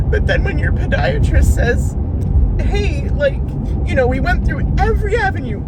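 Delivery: breaking down in loud sobs while venting; crying. Real, not acted. Vlogger venting to viewers about her foot injury, crying loudly. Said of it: "I don't mean to cry".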